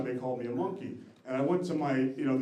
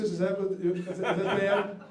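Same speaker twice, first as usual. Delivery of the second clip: ordinary talk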